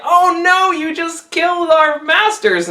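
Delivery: panicked slaves. Acted. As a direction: squeal it out in fright. Frightened Buddhist slaves panic.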